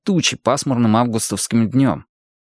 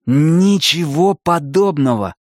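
slow, higher-pitched contradiction; indignant denial; 5 semitones higher, slower than usual. A narrator's reading. Arnie rejecting his friend's warning about the car, indignant.

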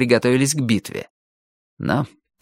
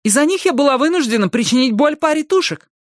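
very high, aggrieved protest; exclaiming. A narrator's reading. Али complains of what attackers forced her to do, exclaiming. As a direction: keep the complaint high and urgent. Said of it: "воскликнула Али".

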